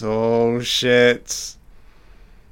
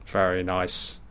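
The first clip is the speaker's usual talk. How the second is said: normal talking voice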